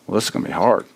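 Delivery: low, soft, defeated sigh of a line; deflated dread. Retold or imitated. Preacher voicing a discouraged first thought, soft and low. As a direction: sound beaten before you even start. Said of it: "you're like".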